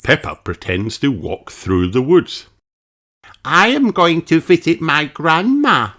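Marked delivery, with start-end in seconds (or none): 3.4-5.9 put-on high, eager little girl voice; Peppa's voice. A narrator's reading. Peppa speaks her stage line, eagerly. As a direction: chirp it like a child acting.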